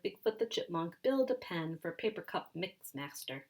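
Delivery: ordinary delivery, nothing marked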